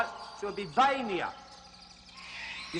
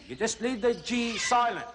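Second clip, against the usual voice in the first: usual speaking voice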